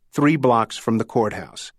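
usual speaking voice

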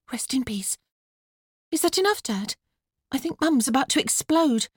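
put-on high girlish voice, hurrying; small high voice wrapping things up; young daughter. A narrator's reading. Daughter cutting the funeral short, high-pitched.

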